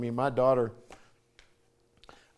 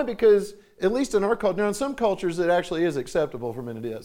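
ordinary talk, nothing marked

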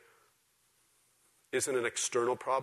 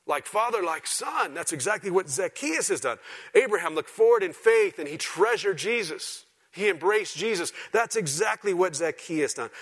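loud, high preaching voice; full-force preaching; 8 semitones higher, 7 dB louder than usual. Real, not acted. Preacher explaining Scripture to his church, preaching at full force. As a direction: drive the point home, raised and forceful.

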